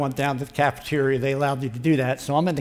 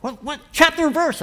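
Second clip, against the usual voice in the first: much higher, louder, keen quizzing; eager question; 13 semitones higher, 6 dB louder than usual. Retold or imitated. Dave quizzing Ken about the Bible, higher and eager. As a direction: press him like an excited friend. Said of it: "He goes".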